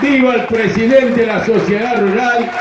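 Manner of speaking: high shouted cheer; rally cheer. Real, not acted. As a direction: lead the crowd's viva. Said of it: "gritemos todos juntos".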